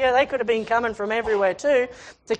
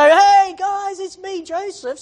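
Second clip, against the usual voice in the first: high hailing call, loud on "Hey"; mock cheerful reveal; 9 semitones higher, 5 dB louder, slower than usual. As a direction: announce yourself to the guys like a big happy surprise.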